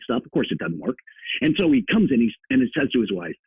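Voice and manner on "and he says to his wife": normal talking voice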